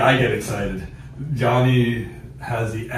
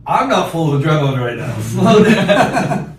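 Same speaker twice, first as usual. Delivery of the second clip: louder, rising to a high yell; laughing yell; 7 semitones higher, 10 dB louder than usual. Retold or imitated. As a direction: call out, half-laughing, to slow him. Yelling at friend running uphill.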